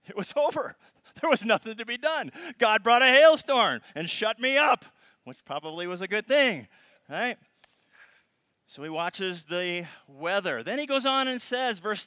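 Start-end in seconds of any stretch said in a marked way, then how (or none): 0.1-7.4 high, loud animated telling; excited storytelling. Real, not acted. Preacher excitedly telling how hail ended service. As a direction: hype up the hailstorm story.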